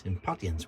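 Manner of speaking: impatiens said im-PAT-ee-enz, falling; alternative pronunciation. Real, not acted. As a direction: say impatiens with a short a, dropping away. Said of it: "whatever you wanna call it".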